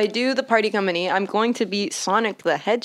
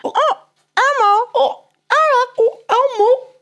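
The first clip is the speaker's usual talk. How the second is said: Elmo voice; 6 dB louder than usual. Pretend. Cooing back at the Elmo voice.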